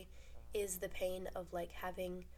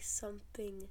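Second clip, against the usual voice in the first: ordinary talk, nothing marked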